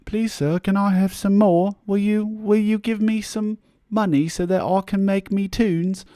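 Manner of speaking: high-pitched mock pleading voice; hat-in-hand beggar. Artist begging supporters for money, hammed-up high beggar voice. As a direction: plead like a poor urchin in a put-on voice. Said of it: "hat in hand".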